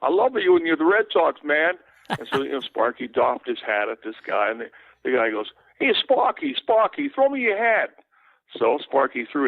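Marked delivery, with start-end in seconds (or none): 0.0-1.7 put-on an adoring Boston fan hollering in his accent. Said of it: "you could tell by the accent". 5.8-7.9 put-on an adoring Boston fan hollering in his accent. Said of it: "you could tell by the accent".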